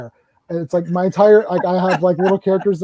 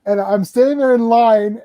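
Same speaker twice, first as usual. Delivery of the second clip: usual speaking voice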